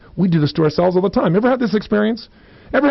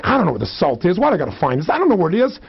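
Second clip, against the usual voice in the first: acted mounting frustration; intense grumbling; 3 semitones higher, faster than usual. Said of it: "You say it with intensity".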